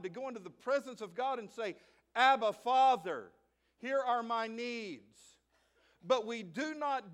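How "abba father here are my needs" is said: slow, with Abba called out loud; a child of God brings his needs to his Father; bold prayer. Shown as a demonstration. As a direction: address God slowly and boldly. Praying boldly to God.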